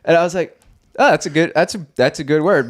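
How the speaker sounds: high, lightly put-on voice trying the new word; mock eager learner. Retelling learning slang from his crew, high mock eagerness. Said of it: "But I'm like".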